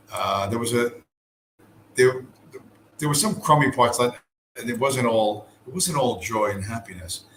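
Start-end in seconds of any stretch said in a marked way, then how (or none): none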